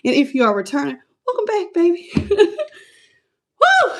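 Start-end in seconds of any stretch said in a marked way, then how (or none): 1.3-3.9 high laughing welcome. Real, not acted. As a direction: laugh through the greeting with a whoop. Laughing welcome to returning viewers.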